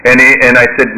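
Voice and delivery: ordinary delivery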